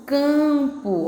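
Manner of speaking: CAMPO with a very long nasal first syllable; syllable demonstration. Shown as a demonstration. Teacher shows pupils the nasal sound, drawn-out demonstration. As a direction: hold the cam of campo, then drop onto the end. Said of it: "Olha aí, ó, cam".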